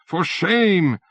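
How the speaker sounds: indignant yell. A narrator's reading. Bureaucrat yelling at the fleeing stranger, indignant yell. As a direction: shame the infidel at full voice. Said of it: "Hussein yells".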